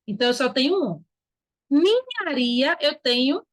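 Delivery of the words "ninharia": NINHARIA stretched slowly, "ni-nha-ri-a"; sounding out the word. Shown as a demonstration. Teacher examining the word for digraphs, sounded out slowly. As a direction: draw out each syllable of NINHARIA. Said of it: "o N H só".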